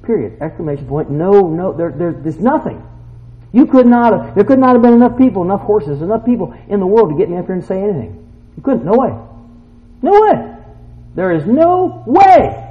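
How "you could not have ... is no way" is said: loud, high, insistent denial; emphatic preaching. Real, not acted. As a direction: preach it forcefully, building to a shout. Preacher at full force.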